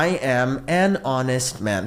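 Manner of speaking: slow, clear teacher model; slow dictation pace; slow model sentence. Shown as a demonstration. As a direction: spell it out slowly for learners. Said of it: "সতের English হচ্ছে honest".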